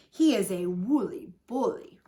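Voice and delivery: ordinary delivery, nothing marked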